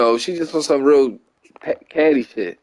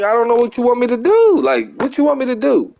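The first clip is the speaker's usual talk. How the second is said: higher-pitched retold protest; exasperated protest; 7 semitones higher than usual. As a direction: act out being at a loss with her. Retells his frustrated answer to her.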